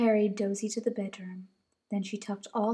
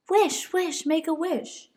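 high, bouncy little bunny voice; eager little bunny; 8 semitones higher, 6 dB louder, slower than usual. Acted. Dozy asking Mama what's for dinner, high eager bunny. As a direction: chant it like a hungry toddler.

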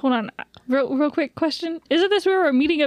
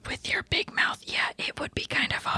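whispering; 7 dB quieter than usual. Acted. Teasing whisper about loudness.

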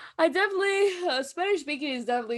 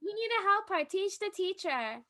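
students teasing; 7 semitones higher than usual. Retold or imitated. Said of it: "They're like".

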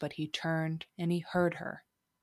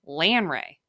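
broad Midwestern flat A in "Lanre", LAN-ray; Midwestern accent; 5 dB louder than usual. Shown as a demonstration. Podcaster feeling particularly Midwestern, "Lanre" in a broad Midwestern accent. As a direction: punch out a Midwestern "Lanre", louder. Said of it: "if I'm feeling particularly Midwestern".

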